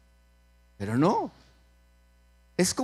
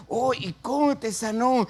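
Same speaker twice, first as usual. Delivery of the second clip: high-pitched, eager acted question; amazed question; 7 semitones higher than usual. Girl asking a healed man how it happened, amazed. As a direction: ask in wide-eyed wonder.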